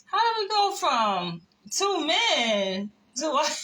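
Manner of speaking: high, loud, incredulous question; amused disbelief. Retold or imitated. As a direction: retell a baffled thought, tickled by it. Baffled inner reaction, retold amused.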